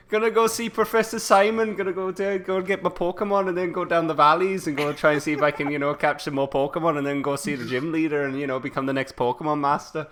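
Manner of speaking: chirpy Welsh lad off to the pub; Welsh accent. Acted. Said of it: "In Cardiff".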